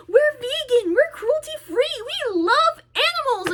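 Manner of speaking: high-pitched singsong mockery; mocking imitation. Mocking people who claim they love animals, sneering imitation.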